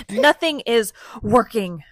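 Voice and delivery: put-on boy frustrated as the project fails; frustrated outburst. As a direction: let the frustration burst out. Frustrated character line.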